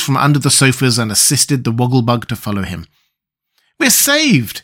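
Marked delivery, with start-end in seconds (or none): 3.8-4.6 high, joyful cry of relief; boy crying out in delight; delighted shout. Acted. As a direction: shout it out, overjoyed. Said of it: "shouted the boy delightedly".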